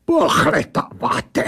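put-on high, drawn-out affronted echo in an accent; creature snaps back. A narrator's reading. Creature throws the narrator's word back, affronted. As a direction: blurt it out, offended, as the creature. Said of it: "vybafla ta věc".

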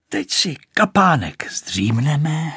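put-on high, slow boyish voice; lightly voiced child suggesting a nap; little boys. A narrator's reading. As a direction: stretch it out in a high voice.

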